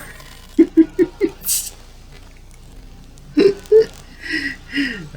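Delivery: loud high helpless laugh; real laughing fit, high and loud; burst of laughter. Real, not acted. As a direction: crack up at your own flub. Laughs at own misreading.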